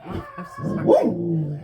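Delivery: sorry blurted out loud and slow over the barking; startled loud apology. Real, not acted. Talking to viewers over a barking dog, a loud slow apology.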